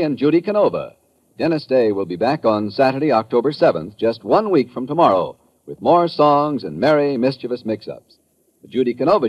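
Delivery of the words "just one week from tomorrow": normal talking voice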